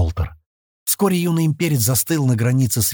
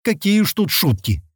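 high-pitched grumble; lightly acted grumpy retort; grumbling; 9 semitones higher than usual. A narrator's reading. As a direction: grumble back in mild complaint. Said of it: "проворчал дважды рождённый".

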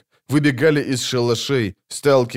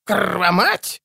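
grating; 7 semitones higher than usual. A narrator's reading. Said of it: "скрежетал где-то фельдмаршал Дуб".

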